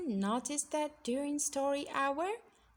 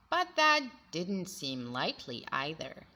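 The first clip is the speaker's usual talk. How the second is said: ordinary delivery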